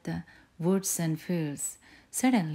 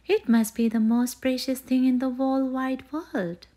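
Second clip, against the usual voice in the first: high and louder, a cub thrilled by her find; excited cry; 7 semitones higher, 7 dB louder than usual. A narrator's reading. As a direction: cry it out in delight. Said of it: "she cried".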